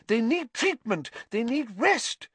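high-pitched, flustered protest; spluttering protest. A narrator's reading. Nurse defending patients' rest, spluttering. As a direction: object indignantly on the patients' behalf. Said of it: "spluttered Madam Pumphrey".

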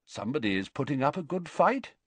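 casual, chatty remark, a little quick; lightly chatting amid danger; conversational. A narrator's reading. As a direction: headmaster calmly chatting with his attacker. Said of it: "said Dumbledore conversationally".

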